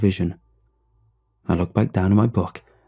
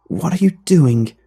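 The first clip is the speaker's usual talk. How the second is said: high, slow and frightened; scared little girl; 7 semitones higher, 4 dB louder than usual. Acted. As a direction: ask it with a tremble of fear. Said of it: "her turn to sound scared".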